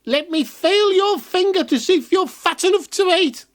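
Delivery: put-on high, far old witch voice. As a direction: wheedle like a hungry witch. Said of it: "every day she said".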